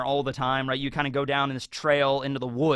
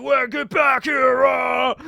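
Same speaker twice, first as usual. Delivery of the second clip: higher, louder, slow cartoon roar; mock angry shout; 8 semitones higher, 5 dB louder, slower than usual. Captain lunging at the girl, hammed-up shout. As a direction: bellow after her like a thwarted giant.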